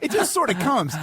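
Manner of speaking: ordinary talk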